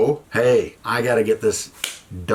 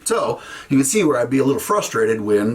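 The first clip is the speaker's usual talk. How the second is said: normal talking voice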